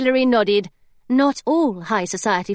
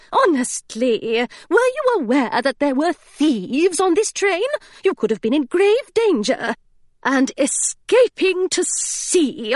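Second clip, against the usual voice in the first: higher, strict governess voice, disapproving; a governess lecturing her foolish charge; prim scolding governess; 6 semitones higher than usual. Acted.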